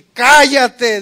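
loud high laughing shout. Shown as a demonstration. Pastor demonstrating a loud shout for the congregation. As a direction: belt it out. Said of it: "di recio".

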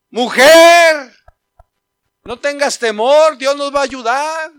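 very loud, high "Mujer"; fervent reassurance. Acted. Husband calling to his worried wife, loud reassurance. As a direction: shout faith into her worry.

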